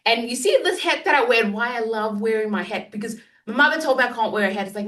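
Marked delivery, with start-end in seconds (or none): none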